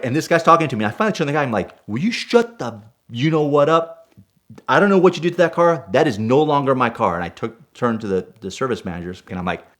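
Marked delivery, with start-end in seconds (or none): 1.9-7.1 high-pitched, indignant retort; angry confrontation. Retold or imitated. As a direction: reenact telling someone off, voice raised. Acted confrontation with body shop guy.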